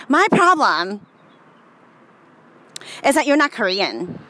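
imitating Higa. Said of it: "I can't do Higa's voice".

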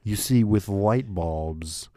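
slow, every word weighed, like a pop-science lecturer; slow, grand explainer voice; Neil deGrasse Tyson. Retold or imitated. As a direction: lay out the cosmos slowly and grandly. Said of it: "do I sound like Neil deGrasse Tyson".

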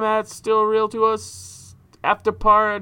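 slow, botched show name on purpose; deliberately wrong plug. Shown as a demonstration. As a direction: fumble the handle slowly on purpose. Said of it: "if I say it wrong".

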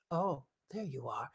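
the madman spotting his target; creepy stranger. Retold or imitated. Vlogger voicing the stranger, soft, slow and eerie. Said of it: "he was like".

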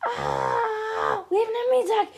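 high, loud frantic cry; panicked alarm. Acted. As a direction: raise the alarm under attack. Frantic alarm during enemy attack.

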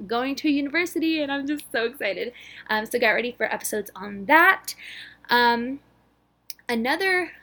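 ordinary talk, nothing marked

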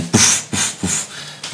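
puffed mouth-made pistol shots; quick voiceless poofs for each shot; imitated gunshots. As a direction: make soft gunshot noises with your breath. Imitating pistol shots in game narration.

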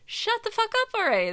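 high, fast exasperated yell; hammed-up shout of a fed-up partner; Phil. Imitating partner fed up with complaints, exaggerated shout. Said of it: "That's what Phil will tell me".

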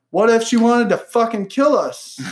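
high-pitched incredulous protest; mock alarm. Host retelling fear over a shared address, in mock alarm. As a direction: blow the worry up comically.

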